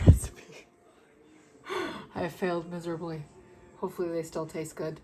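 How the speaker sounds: usual speaking voice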